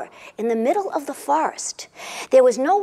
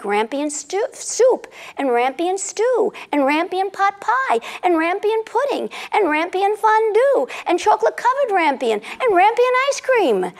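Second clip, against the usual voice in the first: excited listing; 6 semitones higher than usual. A narrator's reading. List of rampion dishes building.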